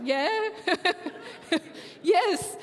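giggly, sheepish acceptance; embarrassed laughing yes. Retold or imitated. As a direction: laugh through a retold tearful answer.

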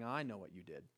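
low and soft like a let-down judge; disappointed God. Acted. God's disappointed stare, voiced.

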